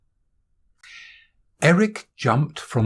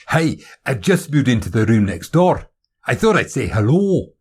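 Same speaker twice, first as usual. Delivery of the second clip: friendly, louder Scottish newcomer; higher-pitched, sociable Scottish voice; Scottish accent; 4 semitones higher, 4 dB louder than usual. Acted. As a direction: introduce yourself heartily in a Scottish accent. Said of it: "Is that a Scottish accent".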